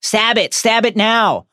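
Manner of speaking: acted shout, urging him to strike; urgent scream. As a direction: yell it as a frantic command. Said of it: "Harry screams at Ron".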